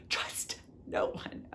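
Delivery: slow, hushed near-whisper; grave warning. Real, not acted. Burned reader warning viewers, slow hushed warning. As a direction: drop to a whisper and draw each word out.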